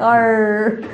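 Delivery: playful drawn-out pirate "arr"; mock pirate. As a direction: play the pirate on one long "arr". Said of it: "did you turn into a pirate".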